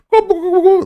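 high, loud turkey gobbles; gobbling turkey voice. Retold or imitated. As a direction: gobble right in their face. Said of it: "great turkey impression".

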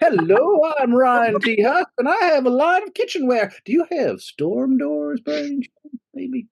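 high, slick salesman pitch; smooth con artist. Retold or imitated. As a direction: sell it like a smooth con man. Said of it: "you sound like a con man to me".

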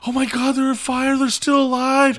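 mock the panicked bystander shrieking for help; imitating a screaming lady. Retelling a lady screaming at the crash, mock panicked shriek. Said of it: "the lady screaming".

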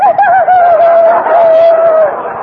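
high, loud cackle; loud mocking laugh. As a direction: laugh it off loudly. Vamp laughs loudly.

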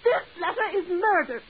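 pleading through a sudden cry; breaking into tears. Acted. Woman begging the postal clerk, breaking into a sob.

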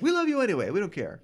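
high, gushing ladies' voice; swooning ladies. Retold or imitated. The ladies swoon over him, high gushing voice. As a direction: gush like adoring fans. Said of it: "they're like".

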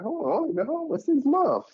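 high, disbelieving double take; mock disbelief, voice jumping up; incredulous hold on. Sailor doing the math on the baby, high and incredulous.

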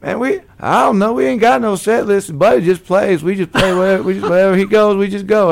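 high, loud laughing reply. Retold or imitated. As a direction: laugh it off, amused by the question. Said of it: "He literally just laughed".

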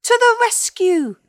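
high, loud heroic cry; tiny rescuer's battle cry; rallying shout. A narrator's reading. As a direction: shout the troops into action. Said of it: "shouted another still".

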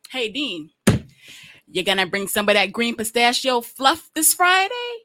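loud, slow, pointed mimicry; exaggerated mock-friendly jab; mocking comeback. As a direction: throw the question back, drawn out and loud. Mocks manager back with same question.

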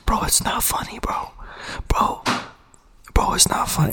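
breathy held-back whisper. Real, not acted. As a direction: whisper it, fighting laughter. Whispering while holding laugh.

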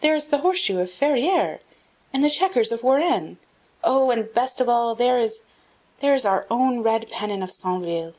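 high, loud, eager exclamations; excited boy. A narrator's reading. Boy at the castle window spotting his people's banners, excited. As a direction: rattle off the banners in delight.

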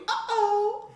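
high-pitched chirped uh-oh; loud, squeaky computer notification; ICQ alert imitation. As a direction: mimic a messenger alert sound. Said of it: "Oh, remember I C Q?".

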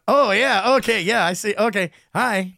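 greeting staring waiters, embarrassed; flustered surprise. Retold or imitated. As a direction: caught off guard by attention.

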